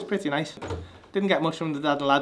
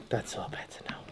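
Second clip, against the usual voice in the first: whispering; 15 dB quieter than usual. Real, not acted. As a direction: whisper it gently. Soothing a baby.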